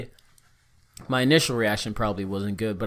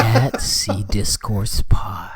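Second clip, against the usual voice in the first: slow whispered promo; 3 semitones lower, much slower than usual. Pretend. Mock promo read.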